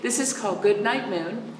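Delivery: plain reading voice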